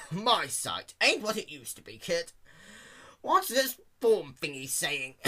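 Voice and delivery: put-on creaky old pony asking; old man voice. A narrator's reading. Elderly pony speaks.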